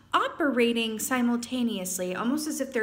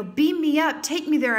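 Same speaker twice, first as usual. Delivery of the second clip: higher and louder, begging to be taken; eager plea; 6 semitones higher, 7 dB louder than usual. Retold or imitated. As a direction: call out eagerly to be beamed up. Imagined plea to the UFO.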